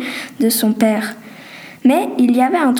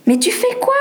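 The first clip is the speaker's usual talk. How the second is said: surprised; 10 semitones higher than usual. Acted. Startled friend questioning.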